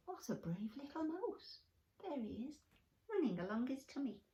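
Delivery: hushed amazement. Acted. Watching creatures marvel at the mouse.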